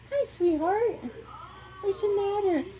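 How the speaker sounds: very high cooing to the dog; pet talk. Real, not acted. Owner greeting dog Chloe, high cooing baby talk.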